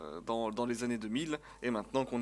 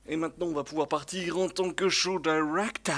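higher and louder, hyping the start of the show; excited announcement; 5 semitones higher, 9 dB louder than usual. Real, not acted. Streamer announces to viewers he becomes show director, excited and loud. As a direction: launch the show like a host pumping up the crowd.

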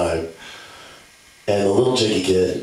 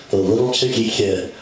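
normal talking voice

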